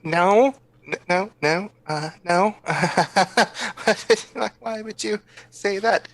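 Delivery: put-on high, stammering kid with a giggle; nervous child denial. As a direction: deny it in a small, high voice. Child character denies stealing.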